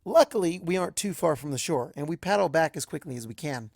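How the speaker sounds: ordinary delivery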